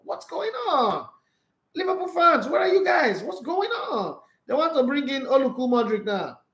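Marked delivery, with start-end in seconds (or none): 0.0-4.2 mock calling out. Real, not acted. Commentator teasing Liverpool fans over their slump, high mock call.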